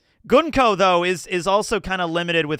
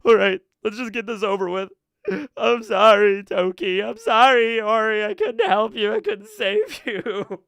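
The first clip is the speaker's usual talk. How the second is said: imitating a tearful apology in a put-on voice; hammed-up sobbing sorry to lost friends; mock crying; 4 semitones higher than usual. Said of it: "He probably starts crying".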